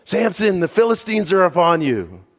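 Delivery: high, loud yell of alarm; a woman yelling a false alarm to her husband. Acted. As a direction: shout the warning across the room. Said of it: "she yells".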